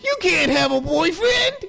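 put-on high, whining little boy; mock a spoiled boy begging his mom; high childish whine, mocking; whiny kid voice. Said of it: "He's just a little bitch boy".

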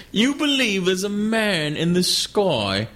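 mock the believer like a condescending Brit; Ricky Gervais impression. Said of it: "my impression of, of them doing Ricky Gervais".

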